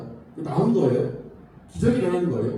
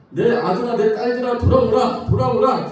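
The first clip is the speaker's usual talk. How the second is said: loud pleading call to come home; 5 semitones higher, 8 dB louder than usual. Acted. God calling his children to return, loud pleading cry. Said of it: "계속 외치세요".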